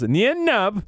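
ordinary delivery

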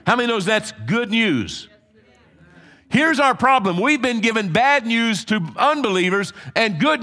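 full-force preaching. Acted. Pastor rallying the congregation, preaching hard. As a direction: pound each phrase home from the pulpit.

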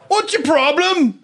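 high, drawn-out mock British challenge; hammed-up British accent, high and slow. Caught driver challenging the woman, hammed-up British. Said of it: "and he's like".

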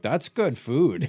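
slow, drawn-out small-town voice, lightly done; a hint of a proud Midwestern local; Wisconsin local. Wisconsin locals praising odd food.

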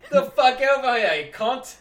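loud, high cockney yelling; British pub yell. Retold or imitated. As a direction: holler like a London bloke. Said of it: "people just yelled at each other".

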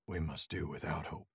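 quiet, grim acceptance; grave and subdued. A narrator's reading. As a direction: say it low, accepting the loss. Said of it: "he said".

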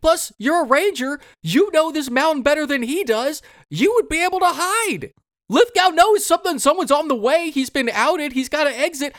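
high-pitched disbelief at a dumb movie choice; incredulous rant. Real, not acted. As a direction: plead with the character to just run. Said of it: "ranting at the heroine's choices".

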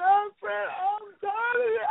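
high-pitched tearful whine; imitated crying friend. Imitating a friend crying to her, high mock sob. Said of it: "'Cause my friend don't cry like that".

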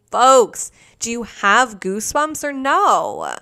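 loud, drawn-out hype to the crowd; milk the punchline, slow and emphatic; showy audience appeal. Real, not acted. Storyteller asking listeners about goosebumps, loudly hyped.

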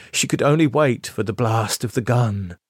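plain reading voice

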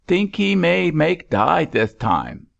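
a sailor grinning over the coffin-bucket, lightly pidgin; grinning Chinese sailor. A narrator's reading. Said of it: "with a grin".

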